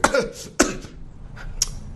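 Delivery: acted coughing fit; fake coughing. Fox faking a cold before the lion, acted coughs. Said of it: "It's coughing".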